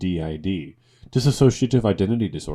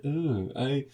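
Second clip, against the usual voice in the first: mock pretentious reader; 6 semitones higher than usual. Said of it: "A pretentious book reader that is like".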